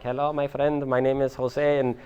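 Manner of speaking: lightly put-on Miami accent, a touch soft; mock his own awkward Miami accent on camera; a hint of a cheesy Miami accent; horrible Miami accent. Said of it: "this horrible Miami accent".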